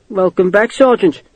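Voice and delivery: laconic. A narrator's reading. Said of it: "Morris said laconically".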